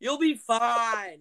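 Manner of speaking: high, loud, drawn-out slowly; hammed-up breezy mom voice, very slow; mock mom reassurance. Mom voiced in anxious story.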